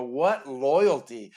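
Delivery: a vendor amazed by his clients' loyalty, in a stunned slow question; stunned disbelief. Retold or imitated. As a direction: let each word hang in disbelief. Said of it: "I go".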